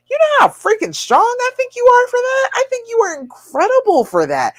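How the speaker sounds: high-pitched, gushing admiration; real excited encouragement; emphatic praise. Real, not acted. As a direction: cheer them on, pitch soaring.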